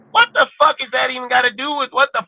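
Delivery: high-pitched angry outburst; real exasperation. Real, not acted. Ranting at people who drag up old topics, exasperated. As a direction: let the frustration push the voice way up.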